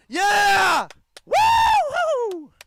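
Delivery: high, loud celebratory whoops; excited cheering. Real, not acted. Commentator whooping for his team's tag out. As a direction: cheer your own team's play. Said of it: "I gotta be excited for my team".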